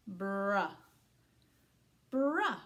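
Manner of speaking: "bruh" as one syllable, said twice; blended syllable. Shown as a demonstration. Teacher modelling the first syllable, blended. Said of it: "the first syllable is bruh".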